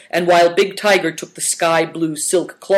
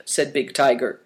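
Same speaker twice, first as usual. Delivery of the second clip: ordinary delivery, nothing marked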